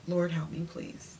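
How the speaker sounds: soft, under-the-breath prayer; retold weary plea, trailing away very softly; exasperated plea. Retold plea after a sigh.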